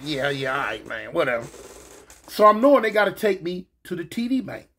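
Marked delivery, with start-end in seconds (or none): 0.0-1.5 low, soft brush-off; quiet, low retold indifference; dismissive. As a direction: mumble along as if unbothered. Acts out brushing guards off.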